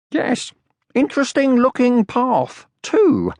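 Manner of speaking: high, loud, put-on casual; fake casual. A narrator's reading. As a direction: say it loud for her to hear. Sniff playing along.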